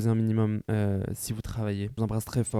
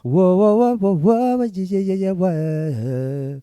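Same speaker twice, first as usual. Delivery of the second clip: ecstatic whoop; 9 semitones higher, 8 dB louder, much slower than usual. Pretend. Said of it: "Je veux du genre".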